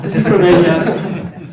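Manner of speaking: loud laugh breaking through the words. Real, not acted. Lecturer laughing as he introduces the limerick, laughing.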